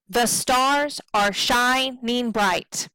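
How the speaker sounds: slow line weighting "stars", "shining" and "bright"; iambic stress. Shown as a demonstration. Teacher reading the scanned line, slow even stresses. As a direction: lean on "stars", "shining" and "bright", keep "The" and "are" light. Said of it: "we have our stressed and unstressed".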